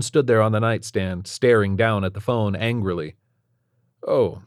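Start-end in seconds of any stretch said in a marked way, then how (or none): none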